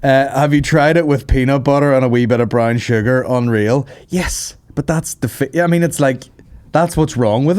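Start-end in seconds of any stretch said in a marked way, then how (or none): none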